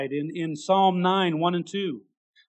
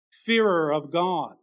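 ordinary delivery

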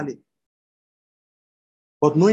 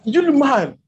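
Pilate threatening; 7 semitones higher, 5 dB louder than usual. Retold or imitated. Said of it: "He said".

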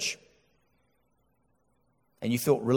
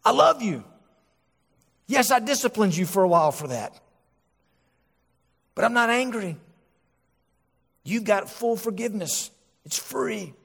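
lightly voiced gentle father, pitched higher; God the Father; 7 semitones higher than usual. Father God telling his children it's over, gentle reassurance. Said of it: "God the Father saying".

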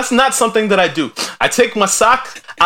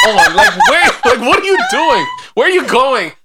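much higher, loud rapid questions; incredulous grilling; 6 semitones higher, 4 dB louder than usual. Real, not acted. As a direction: bombard her with questions. Mock interrogation, laughing.

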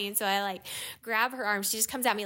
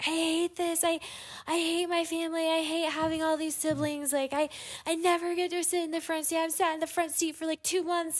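retold bawling fit; 8 semitones higher than usual. Woman retelling a kid losing it over the front seat, mock bawling. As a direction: imitate an upset kid's wail in a much higher voice. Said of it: "starts bawling and screaming and is like".

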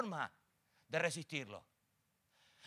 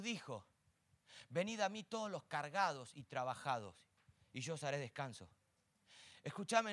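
soft, intimate sermon close; low soft pastoral appeal; hushed tender preaching; 7 semitones lower, 12 dB quieter than usual. Acted. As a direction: draw them in quietly, pleading gently. Intimate closing exhortation.

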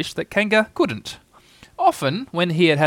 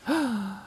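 high, quick gasp; startled little intake of breath; 10 semitones higher, 7 dB quieter than usual. A narrator's reading. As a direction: gasp with sudden hope. Piglet sees his rescuer.

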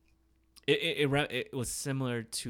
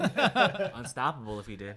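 laughing; 3 semitones higher, 9 dB louder, slower than usual. Real, not acted. Laughing remark.

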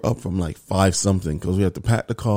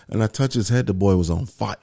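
normal talking voice

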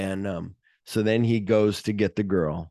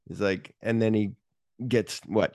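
normal talking voice

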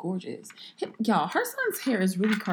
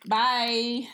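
ordinary delivery

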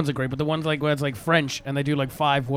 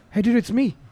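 cab driver; 8 semitones higher than usual. Retold or imitated. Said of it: "they're just like".

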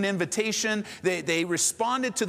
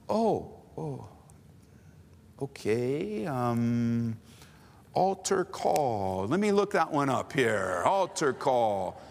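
low, halting, drawn-out words; hesitant, puzzled searching; 7 semitones lower, much slower than usual. Acted. New convert searching the Bible for altar call, hesitant and puzzled. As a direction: stall slowly while looking it up.

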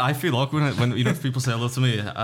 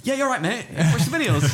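forced cheer; 10 semitones higher than usual. Retold or imitated. Fake cheer after meltdown.

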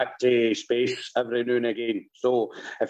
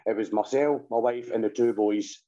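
ordinary delivery

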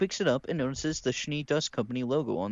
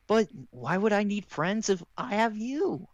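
Ruby voice; 9 semitones higher than usual. Acted. Ruby line in script.

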